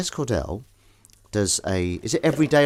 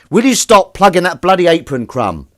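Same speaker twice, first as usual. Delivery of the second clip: loud, higher shout of exasperation; exasperated shout; 8 semitones higher, 12 dB louder than usual. Real, not acted. Vlogger yells at partner about the apron, loud and exasperated. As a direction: yell across the room at him.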